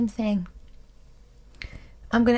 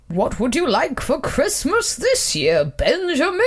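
slow, rolling Santa voice; put-on Father Christmas, lifting at the end; slower than usual. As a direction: draw it out like a department-store Santa. Santa asks what Benjamin wants.

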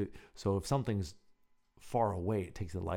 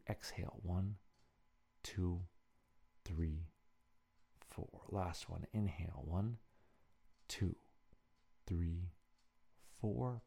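soft, slow counting of breaths; 5 dB quieter, slower than usual. Shown as a demonstration. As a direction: count each breath gently and slowly. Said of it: "Let's take a couple of deep breaths".

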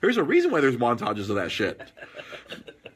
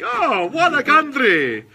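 put-on slow, high, loud Russian accent; a put-on Russian accent, stretched out slowly; Yakov Smirnoff Russian accent; 11 semitones higher, 7 dB louder, much slower than usual. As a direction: ham up an eighties Russian comic accent.

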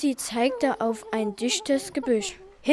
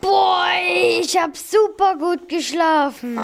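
happy waking stretch; 6 semitones higher than usual. Acted. Girl waking up happily.

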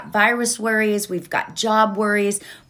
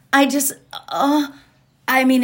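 ordinary talk

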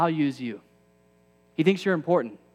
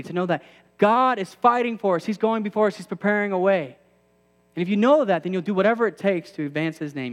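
high, loud preacher build; preaching; 4 semitones higher, 4 dB louder than usual. Acted. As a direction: drive it home to the congregation. Preaching at full force.

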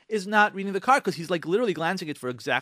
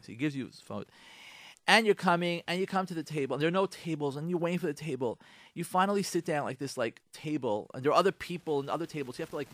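ordinary talk

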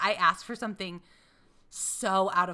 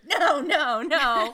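mock disgusted refusal; 7 semitones higher, 7 dB louder, much slower than usual. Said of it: "going through the Harris Teeter".

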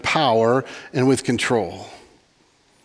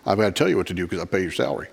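put-on Southern accent; 7 semitones lower, 6 dB quieter, faster than usual. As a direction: imitate an entitled Southern churchgoer. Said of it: "they're always from the South when I mimic that".

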